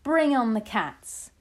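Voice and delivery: loud, slow rallying call; shouted. A narrator's reading. Child rallying grandmother to the plan, shouting. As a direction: draw it out like a war cry. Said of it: "I shouted".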